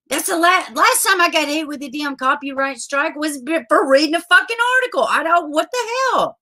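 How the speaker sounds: high, indignant venting; pissed off. Real, not acted. Streamer recalling her last strike, pissed off. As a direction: rant in real disbelief. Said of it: "that pisses me off".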